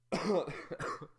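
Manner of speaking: a real cough that cracks high and breaks; sudden cracked squeak while coughing. Real, not acted. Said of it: "That voice crack was so bad".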